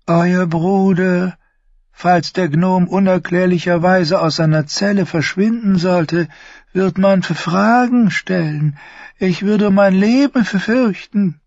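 Varys gasps high and startled; gasped. Acted. Varys' hohe Stimme. (gekeucht, hoch und erschrocken)

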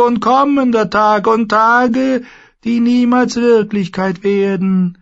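very high, loud, eerie warlock voice; a thin, uncanny sorcerer. Acted. The warlock Pyat Pree bars the way, high and eerie.

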